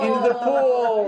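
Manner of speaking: high, slow laughing shout; playful splash shout. Pretend. Finishing the tightrope tale for the listeners, gleeful high shout. Falling into the pool.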